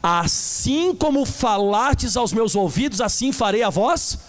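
slow solemn reading. Acted. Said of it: "De novo".

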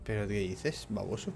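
pregunta susurrada, muy bajita; devuélvele el insulto en un susurro; réplica susurrada. Pretend. (réplica en voz muy baja)

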